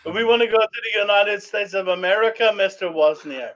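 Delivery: hammed-up Czechoslovakian accent on the plea; mock Czechoslovakian accent. Drunk friend pleading to Mister Wozniak in mock Czechoslovakian accent. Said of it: "Czechoslovakian fucking accent".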